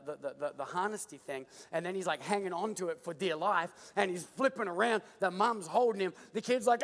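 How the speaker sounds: normal talking voice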